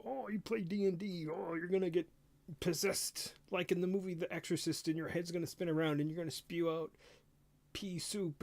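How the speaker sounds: mock alarmist. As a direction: imitate a panicked moral crusader. Voices satanic panic alarmists.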